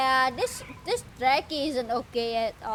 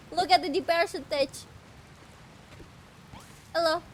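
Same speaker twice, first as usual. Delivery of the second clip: usual speaking voice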